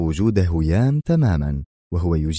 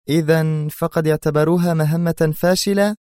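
high-pitched female character voice; murmuring woman; 9 semitones higher than usual. A narrator's reading. She asks if his mission was judged a failure, high-pitched. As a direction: question him in a raised female pitch. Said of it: "أطرقت برأسها وهي تغمغم".

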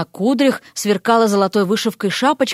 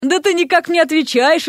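mild, higher-pitched surprise; surprised; 6 semitones higher than usual. A narrator's reading. Young man notices the frog replying, surprised. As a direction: marvel that it talks back. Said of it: "удивился молодец".

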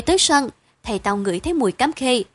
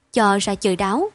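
plain reading voice

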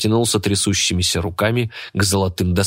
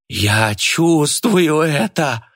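ecstatic yell; 7 semitones higher, much slower than usual. A narrator's reading. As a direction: cry out in wild delight. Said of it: "восторженно завопил Кайратов".

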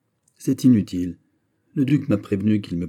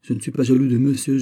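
vehement denial; 5 semitones higher, 7 dB louder than usual. Acted.

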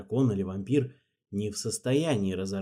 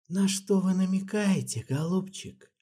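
insinuating; 8 semitones higher than usual. A narrator's reading. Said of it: "спросил Марбл вкрадчиво".

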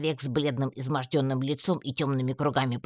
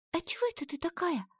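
put-on much higher, softer girlish surprise; surprised Алька; 5 dB quieter than usual. A narrator's reading. Алька wonders at Татьяна's clothes, high surprised voice. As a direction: ask it amazed at her outfit. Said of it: "удивлённо продолжила напарница".

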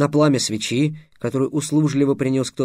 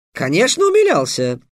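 high start, quickly falling; exclamation; 6 semitones higher, 4 dB louder than usual. A narrator's reading. Slave trader eagerly admitting it to Trix, exclaiming. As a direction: agree with a lively burst. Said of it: "воскликнул работорговец".

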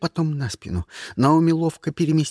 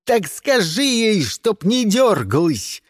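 high, drawn-out shouted command; exasperated order; 6 semitones higher, 3 dB louder, slower than usual. A narrator's reading. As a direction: snap at your henchman, stretching the words. Lars angrily orders Karl. (раздражённо кричит, растягивая слова)

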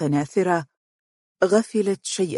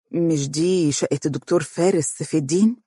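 plain reading voice

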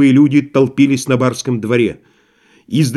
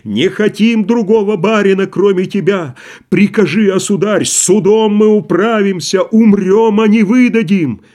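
higher, louder desperate cries; shouting crowd; 8 semitones higher, 3 dB louder than usual. A narrator's reading. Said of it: "кричали они". (громкий крик толпы)

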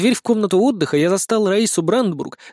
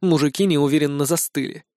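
ordinary delivery, nothing marked

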